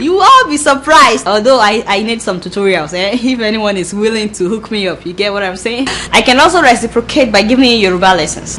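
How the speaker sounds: ordinary talk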